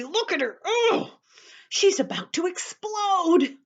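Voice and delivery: excited. Acted. Excited reading to children.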